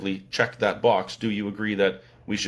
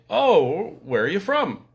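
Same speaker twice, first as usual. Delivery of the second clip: higher, badly faked American accent; attempted American accent; 8 semitones higher, slower than usual. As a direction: imitate a surprised American with a poor accent. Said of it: "I can't even do an American accent".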